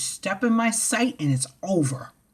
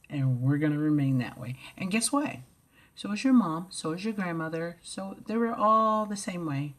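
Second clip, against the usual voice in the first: normal talking voice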